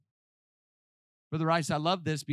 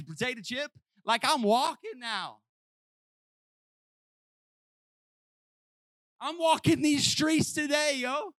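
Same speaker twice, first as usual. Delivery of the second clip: cocky healed man; 11 semitones higher than usual. Retold or imitated. Preacher voicing healed man answering officials, high swagger. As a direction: brag like a freed man. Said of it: "they're like".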